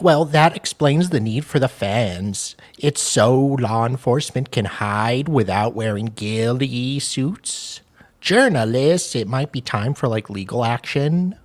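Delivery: Holly. Acted. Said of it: "you wanna be Holly?".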